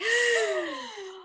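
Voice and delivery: sharp high startled gasp. Retold or imitated. The heroine turns and sees Philip behind her, high startled gasp. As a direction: gasp as if caught soaking wet.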